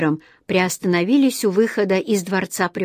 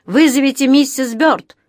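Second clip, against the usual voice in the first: loud, higher-pitched courtroom call; 6 semitones higher, 5 dB louder than usual. A narrator's reading. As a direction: call it down a long hall. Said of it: "крикнул кто-то в дальнем конце зала".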